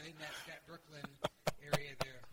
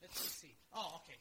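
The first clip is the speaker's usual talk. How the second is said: ordinary talk, nothing marked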